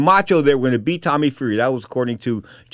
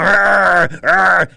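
loud imitated dog snarl; high, loud mock growling; 5 semitones higher, 5 dB louder than usual. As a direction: growl like a big dog going for someone. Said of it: "So he's going".

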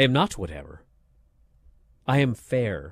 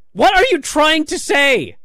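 exasperated; 9 semitones higher, 4 dB louder than usual. Real, not acted. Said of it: "exasperated rant about delivery".

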